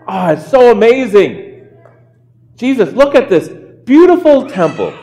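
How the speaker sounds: high-pitched gushing wonder, acted out; playing a wide-eyed, amazed disciple. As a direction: marvel like an awestruck tourist. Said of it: "his disciples are amazed".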